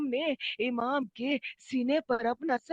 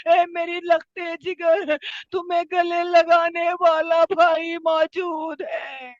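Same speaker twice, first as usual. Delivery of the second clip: tearful anguish; 4 semitones higher, 5 dB louder than usual. Acted. Dying Imam comforting his daughter, tearful wail. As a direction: cry it out as though weeping. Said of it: "تڑپ کر رو کر کہا ہوگا".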